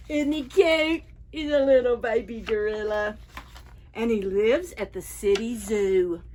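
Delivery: ordinary delivery, nothing marked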